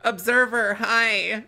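excited greeting. Acted. Greeting a game character.

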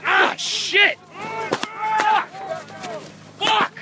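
yelling in pain and anger; cursing loudly after being hit; furious shout. Real, not acted. Hit while filming protest.

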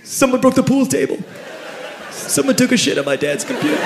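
mildly voiced worried host; upset host. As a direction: complain like the party victim. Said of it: "And he said".

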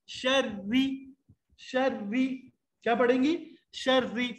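شری with a light, thin ر, very slow. Shown as a demonstration. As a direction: draw out شری gently with a fine ر. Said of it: "اس کو باریک پڑھتے ہیں".

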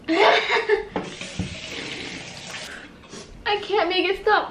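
laughing through burning pain. Real, not acted. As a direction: laugh helplessly while the mouth burns.

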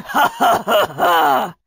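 high, shouted gloating cackle; villain laugh. Acted. Kunatos thinks Tia is trapped, villainous laughing. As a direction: cackle in triumph over your prey.

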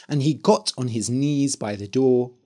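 ordinary delivery, nothing marked

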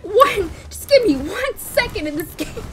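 high, loud, slow frightened pleading; scared horror game streamer. Real, not acted. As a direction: whimper and yell while chased. Fleeing enemies in game.

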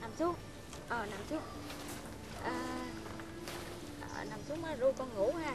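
put-on calm a crying child with tender coaxing; soothing old mother. Old mother hushing her grown son to lie down, tender.

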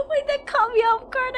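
high, breaking into laughter mid-line; half-laughing it out, caught off guard. Real, not acted. Streamer caught off guard by a funny clip, laughing. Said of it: "I'm laughing".

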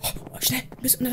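hushed, voiceless hurry-up whisper; urgent whisper. Pretend. Intruder must hide under the bed, whispering urgently. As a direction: whisper it as you rush to hide. Hiding from waking friend.

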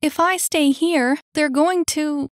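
high, loud, urgent objection; alarmed protest. Acted. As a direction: argue against being left behind. Annie protests being left.

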